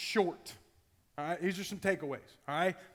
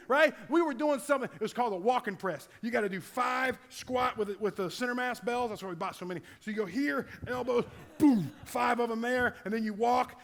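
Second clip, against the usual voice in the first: ordinary talk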